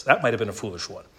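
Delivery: ordinary talk, nothing marked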